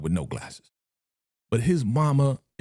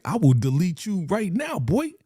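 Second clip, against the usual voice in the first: mock threat; 7 semitones higher than usual. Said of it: "Jackie was like".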